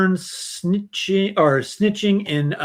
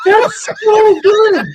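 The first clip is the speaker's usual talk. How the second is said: laughing shout; 10 dB louder, slower than usual. Real, not acted. Host reacts to the song.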